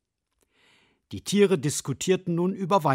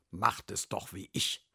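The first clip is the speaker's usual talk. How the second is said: much lower, soft drowsy badger; sleepy badger; 7 semitones lower, 5 dB quieter than usual. Badger advises sleeping, low and drowsy.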